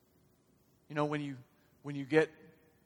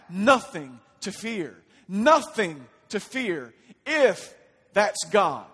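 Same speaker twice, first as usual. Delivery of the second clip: loud, raised, emphatic declaration; forceful preaching; 7 semitones higher, 13 dB louder, slower than usual. Real, not acted. Preacher telling the congregation there's nothing to fear, forceful preaching. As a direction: build to slow, punched proclamation.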